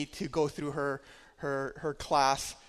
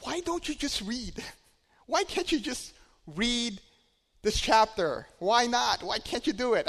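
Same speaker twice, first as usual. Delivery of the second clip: much higher, pleading why questions; exasperated pleading; 8 semitones higher than usual. Retold or imitated. Tutor begging the daydreaming student, exasperated high pleading. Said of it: "I just told her".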